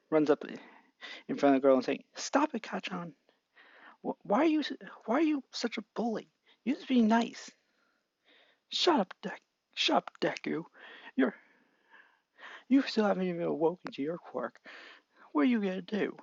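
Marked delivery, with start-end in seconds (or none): 2.2-7.5 put-on pleading boy voice. Young Deku pleads with Bakugo to stop bullying, high timid voice. 8.7-16.2 put-on higher, scornful kid voice, ending in a whisper; sneering bully. Bakugo mocks Deku for having no quirk, high sneer.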